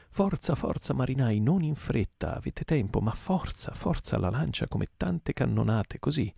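high, urging shout at the oarsmen; shouting Stab. Acted. Mate Stab spurring his rowers, a high urging shout. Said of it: "gridava Stab sputacchiando".

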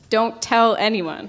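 don't tell anyone, each word slow and stressed; emphatic, slow warning. Shown as a demonstration. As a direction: spell out "don't tell anyone" slowly like capital letters. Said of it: "capital letters".

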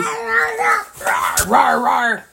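monster roar. Acted. Parent voices monster roar in story.

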